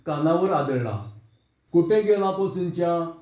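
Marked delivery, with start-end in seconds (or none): none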